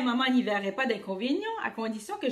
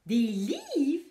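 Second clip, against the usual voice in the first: ordinary delivery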